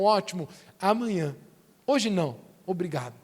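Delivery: low, dismissive acted refusal; polite brush-off. Us answering Jesus' offers, politely dismissive.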